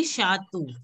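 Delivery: Shatu with a final long O; pronunciation demo. Shown as a demonstration. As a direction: say Shatu ending like the letter O. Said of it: "it is pronounced as O".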